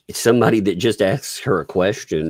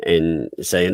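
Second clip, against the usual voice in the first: ordinary talk, nothing marked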